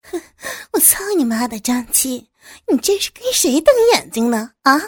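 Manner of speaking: high, loud angry yell; shouting. Acted. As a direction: bark at him to back down. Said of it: "喊了起来".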